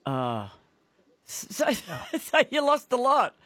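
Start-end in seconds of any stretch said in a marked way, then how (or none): 2.2-3.3 laughing, much higher voice. Real, not acted. Host teasing a caller who lost every fish, laughing. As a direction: say it through amused laughter.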